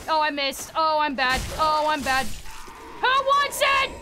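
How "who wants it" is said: high, fierce battle challenge; high combative shout; yelled taunt. Real, not acted. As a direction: yell the challenge at your enemies. Taunt turned into yell.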